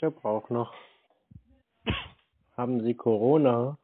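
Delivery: ordinary talk, nothing marked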